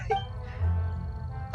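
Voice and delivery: breathless, mostly voiceless laugh; real laughter. Real, not acted. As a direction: let out a helpless wheeze of laughter. Still laughing at the joke.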